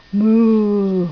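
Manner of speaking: long drawn-out moo; cow moo. Shown as a demonstration. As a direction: imitate a cow's long moo. Models the cow's sound for toddler.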